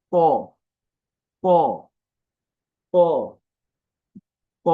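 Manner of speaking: "প" said as a thick Arabic "to"; tongue tip at upper tooth roots for a full, rounded "প"; isolated emphatic t with an o-coloured vowel on "প"; heavy t. Shown as a demonstration. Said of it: "আওয়াজটা মোটা হবে".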